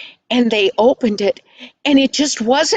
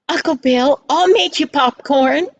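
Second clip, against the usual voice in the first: lightly voiced eager girl, higher pitch; eager child; 5 semitones higher than usual. Girl offering her uncle popcorn, lightly voiced eagerness.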